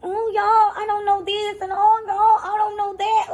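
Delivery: high, put-on shy girl voice; imitating a nervous shy girl; lightly hammed timid teen; mock timid girl. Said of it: "all timid and scared".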